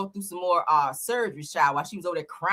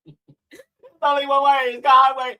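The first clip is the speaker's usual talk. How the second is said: slow, hammed-up crying plea, louder at the end; mock crying; 5 semitones higher, 6 dB louder, slower than usual. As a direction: act out her tearful wail. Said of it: "see it and reenact it".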